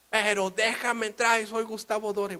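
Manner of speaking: high, loud protest; exasperated plea. Acted. Painter begging customs officer, exasperated plea. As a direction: plead loudly, at the end of your patience.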